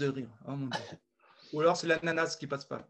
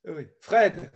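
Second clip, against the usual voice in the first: ordinary delivery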